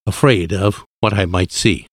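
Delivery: usual speaking voice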